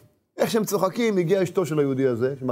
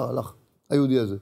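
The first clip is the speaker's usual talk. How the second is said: ordinary talk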